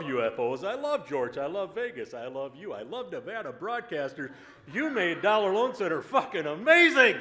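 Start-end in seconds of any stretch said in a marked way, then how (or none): 4.7-7.1 a loud shout to the crowd. Real, not acted. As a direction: shout the praise to the room.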